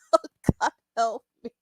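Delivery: usual speaking voice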